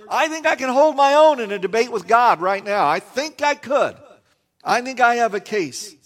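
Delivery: a man sure he can out-argue God; defiant claim. Retold or imitated.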